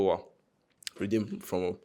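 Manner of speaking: usual speaking voice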